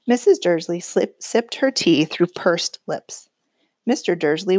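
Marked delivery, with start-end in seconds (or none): none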